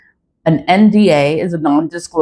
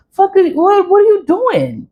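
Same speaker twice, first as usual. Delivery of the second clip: high, squeaky mock voice; mocking disbelief; 13 semitones higher than usual. Scoffing at people who reverse a spell, in a high mock voice. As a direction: send up someone undoing their own spell.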